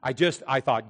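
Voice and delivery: ordinary talk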